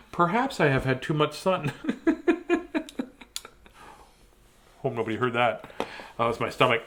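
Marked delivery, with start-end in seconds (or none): 1.8-3.0 high giggle. Real, not acted. Laughs at own silliness.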